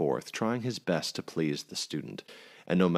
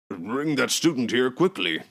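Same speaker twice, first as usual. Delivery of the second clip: higher, louder urgent call; 8 semitones higher, 6 dB louder than usual. A narrator's reading. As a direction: hurry the servant along. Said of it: "called Mister Androsh".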